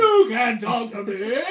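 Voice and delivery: loud, slow laughing protest; mock outraged yell. Hammed protest.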